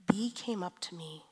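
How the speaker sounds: normal talking voice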